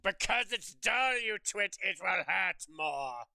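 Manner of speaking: slow, heavy, irritated delivery; hammed-up scolding, drawing out each word; exasperated villain. Villain explaining the spoon to a twit, exasperated. Acted villain snaps at questioner.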